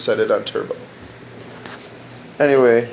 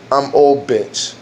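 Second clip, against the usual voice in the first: slow, drawn-out mock phone voice; drawled prank-call reply; much slower than usual. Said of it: "call new bitch on the phone and be like".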